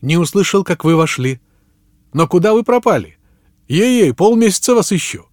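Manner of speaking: high, loud, eager greeting; excited exclamation. A narrator's reading. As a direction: exclaim it with rising pitch and delight. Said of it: "воскликнул он".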